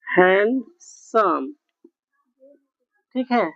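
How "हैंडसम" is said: dictating handsome slowly; slow drawn-out word. Shown as a demonstration. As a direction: stretch handsome slowly for students. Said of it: "ये handsome है".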